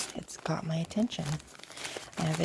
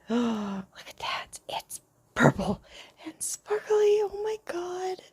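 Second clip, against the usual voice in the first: high, thrilled hushed squeal; delighted breathless oh my God; excited gasp; 13 semitones higher, 3 dB louder than usual. Real, not acted. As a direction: gush over the sparkly purple find. Gasps at a favorite item.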